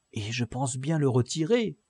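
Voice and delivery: usual speaking voice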